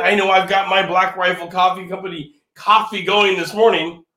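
ordinary delivery

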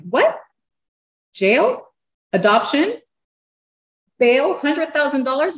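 high, loud, incredulous questions; stunned disbelief. Retold or imitated. Client echoing the lawyer's threats, in shocked disbelief.

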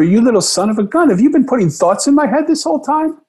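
high, excited amazed cry; father to daughter, overjoyed and amazed; delighted disbelief. Retold or imitated. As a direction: squeal it with joyful astonishment. Delighted realization, retold exclamation.

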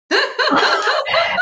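a laugh shooting up high; delighted high laugh. Real, not acted. Laughing at cranky patient.

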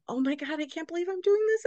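high, hushed incredulity; retold disbelief. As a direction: squeal it under your breath in mock disbelief. Retelling own incredulous inner reaction.

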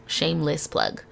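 softly muttered second shameless plug; quiet aside. Real, not acted. Self-aware aside after plugging program.

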